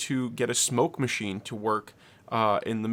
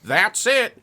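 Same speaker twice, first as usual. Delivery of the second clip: raised, high mock outburst; imitated pissed off; 8 semitones higher, 6 dB louder than usual. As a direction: play the roommate finally snapping. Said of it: "he gets so pissed off".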